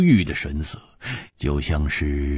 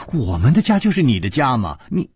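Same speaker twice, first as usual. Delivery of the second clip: higher, quick insistent plea; anxious urging; 6 semitones higher, faster than usual. A narrator's reading. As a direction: rush to reassure her, urgently. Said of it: "李红秀也着急地说". (着急地劝说)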